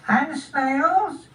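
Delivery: high-pitched little snail voice; small high snail question; inquiring snail. Acted. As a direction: ask as a tiny, polite snail.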